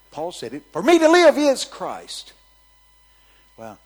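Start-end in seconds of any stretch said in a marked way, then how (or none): 0.7-2.3 high, loud, drawn-out declaration; loud proclamation. Retold or imitated. Preacher quoting Paul to the congregation, loud proclamation. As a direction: proclaim it at full volume, slowly.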